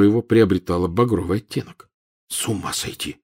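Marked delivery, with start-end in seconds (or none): 2.3-3.2 much softer, low mutter; soft, low disgruntled aside; grumbled. Acted. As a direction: grumble it under breath. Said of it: "буркнул Гарри".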